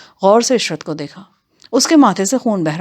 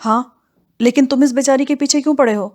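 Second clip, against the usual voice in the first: higher-pitched challenging question; a touch of indignant pleading, pitched up; mild protest; 6 semitones higher than usual. Acted. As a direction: confront him on the girl's behalf. Narrator confronts bandit over the girl.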